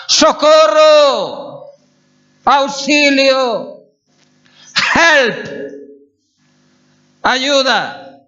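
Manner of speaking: high, long shouts, one after another; desperate cries for help. Shown as a demonstration. Swimmer exhausted in the whirlpools calling out, desperate shouting. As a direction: shout for rescue as if drowning. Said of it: "Eso es lo que significa Shabbat".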